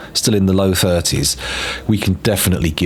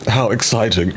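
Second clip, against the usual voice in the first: hiccuping; much slower than usual. Real, not acted. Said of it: "burping and hiccuping into the microphone".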